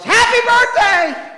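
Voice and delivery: sudden high, full-volume yell. Retold or imitated. Daughter creeps round the corner to startle the preacher, yelling. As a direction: shout it drawn out to startle someone. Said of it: "and yells".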